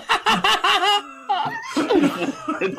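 squealing burst of laughter; high shrieking laugh. Real, not acted. Laughing hard at joke.

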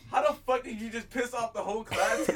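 high, loud mock outrage; retold outrage. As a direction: imitate an incredulous outburst, amused. Retells classmates' outraged question.

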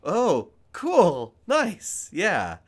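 high, loud, halting imitation of being flustered; caught off guard. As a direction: fumble for a reply to unexpected praise. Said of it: "I just didn't expect that".

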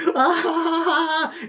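high, strained laughing voice; a frazzled GM laughing high and loud; high manic laugh. Retold or imitated. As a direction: cackle like a man losing it. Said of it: "And Alex is like".

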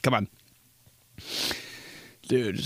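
ordinary talk, nothing marked

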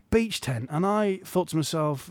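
ordinary talk, nothing marked

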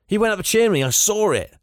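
high, excited acted report; amazed witness. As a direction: insist on the marvel you witnessed. Amazed witness reports Santa's escape.

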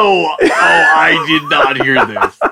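loud, slow, laughing disbelief; half shout it through a laugh. Real, not acted. Cohost hearing the Bob story, laughing in disbelief.

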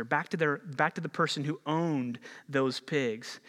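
usual speaking voice

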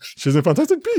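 high, surprised realisation. Real, not acted. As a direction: sound genuinely taken aback. Surprised to learn.

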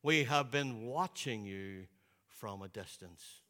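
deep, slow, hushed imitation of Sidney Poitier; solemn elder's voice, hushed; Sidney Poitier impression. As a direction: say it low and slow like a grand old actor. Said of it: "All right, Sidney Poitier".

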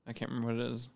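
ordinary talk, nothing marked

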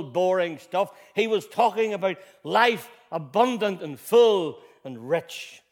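loud, high, rising preacher's cry; fervent sermon delivery; full-force preaching. Acted. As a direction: preach it out to the back row. Sermon climax on abundant life.

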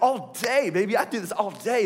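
put-on squeaky high, loud boast like a kid showing off; overexcited. Rich young ruler boasting to Jesus, pumped and high-pitched. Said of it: "he's just really pumped".

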